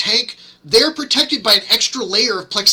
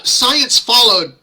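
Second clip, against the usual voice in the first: normal talking voice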